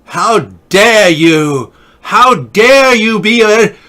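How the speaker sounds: high, loud, slow furious mock Greta; hammed-up Greta, drawing out each dare; Greta impersonation. Imitating Greta to the camera, a loud, slow, high mock rage. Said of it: "I'm, I'm working on my Greta impersonation".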